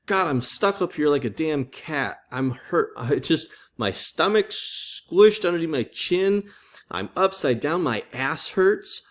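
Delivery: mock-whiny Michelangelo griping; complaining Michelangelo. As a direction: whine it like a sore, cramped artist. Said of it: "he's just complaining".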